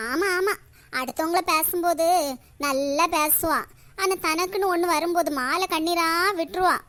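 normal talking voice

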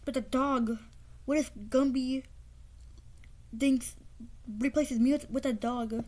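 ordinary talk

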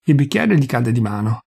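plain reading voice

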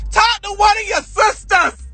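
high-pitched, hammed-up protest; exasperated outcry. Child telling mother to confide elsewhere, exasperated outcry. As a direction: cry out in mock desperation.

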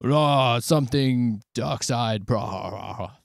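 hammed-up demon roar, drawn out. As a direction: growl it slowly like a pretend demon. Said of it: "like, the demon voice".

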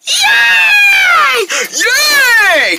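high, loud squeal of joy; excited yay. Acted. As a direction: cheer like a thrilled kid. Kids cheer at cookie invite.

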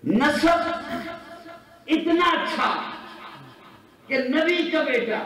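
high, ringing sermon delivery; full-force preaching. Real, not acted. Preacher setting lineage against character, high impassioned preaching. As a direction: declaim in short, separated phrases.

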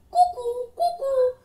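high cuckoo calls; comic cuckoo chime; cuckoo bell. Acted. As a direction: sing out cuckoo twice.

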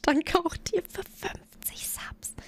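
slow breathy whispered thanks; hushed, slow gratitude. Acted. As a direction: lean in and whisper the thank-you. Whispered thank-you to a subscriber.

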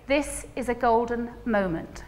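slow, soft "This is a golden moment"; calm meditative delivery; calm, slow and low. Shown as a demonstration. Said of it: "And then you say".

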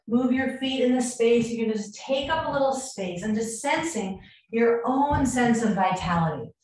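ordinary talk

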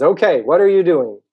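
high, loud shouted question; calling out. Acted. Turtle asking the mole from afar, calling out. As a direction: holler it to someone out of earshot.

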